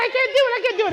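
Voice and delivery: high, loud pleading; panicked plea. Retold or imitated. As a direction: beg desperately. Refusing in panic.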